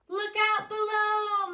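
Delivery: high, loud, drawn-out yell; scream. Acted. Molly yelling to everyone as she races down the hill. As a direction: holler it while racing downhill. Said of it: "Molly Lou Mellon screamed".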